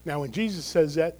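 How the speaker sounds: normal talking voice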